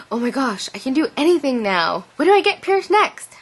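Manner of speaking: giddy with new courage; squealing in rising excitement; thrilled. Retold or imitated. Quoting her own thrilled thoughts.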